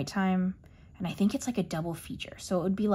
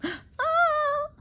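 delighted squeal. Real, not acted. Sees a delightful page.

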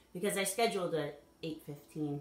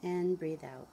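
measured meditation guide with long pauses; guided meditation; 6 dB louder than usual. Acted. Guide asking listeners to follow the breath, guided meditation. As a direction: lead them gently through it, unhurried.

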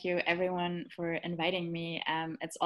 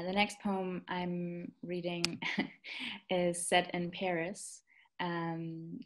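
usual speaking voice

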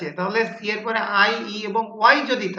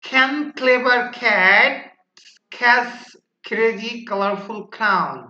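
slow, louder start with spaced-out words; slow first take; 3 dB louder, much slower than usual. Shown as a demonstration. Teacher models the first tongue-twister round for students, slowly. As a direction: say the tongue twister slowly, pausing between chunks. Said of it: "প্রথমবার একটু ছলো".

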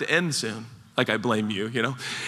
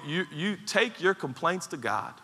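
normal talking voice

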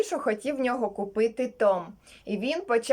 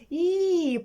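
high, long "І" through a smile; smiling "ee"; 6 semitones higher than usual. Acted. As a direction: sing out a smiley "ee". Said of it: "посміхнувся наче". (високе усміхнене «І»)

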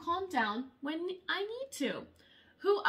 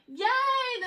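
cheer; 9 semitones higher, 5 dB louder than usual. A narrator's reading. School bell rings.

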